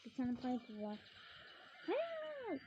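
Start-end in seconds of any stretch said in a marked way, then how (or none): 1.9-2.6 high, sharp no of dismay; a pained squeal at losing; dismayed cry. Real, not acted. Gamer squeals no as the match goes wrong, dismayed.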